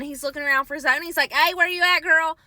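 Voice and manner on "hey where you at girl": calling out. Retold or imitated. Assistant calls out for Zona.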